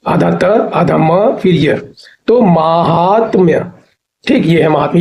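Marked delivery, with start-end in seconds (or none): 2.4-3.6 slow माहात्म्य with long opening maa; correct form. Shown as a demonstration. Teacher putting the parts together, slow माहात्म्य with long maa. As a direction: stretch माहात्म्य as maa-haat-mya. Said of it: "ये correct है".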